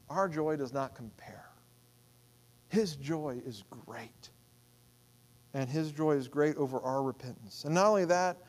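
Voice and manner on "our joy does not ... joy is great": soft whisper, lower. Real, not acted. Preacher whispering the refrain to the congregation. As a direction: breathe it as an aside.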